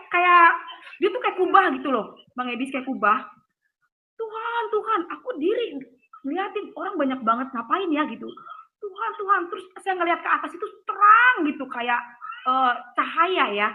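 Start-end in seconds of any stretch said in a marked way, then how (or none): 4.2-5.0 high pleading call of a crowd; imitating people calling out to God. 8.8-9.4 high pleading call of a crowd; imitating people calling out to God.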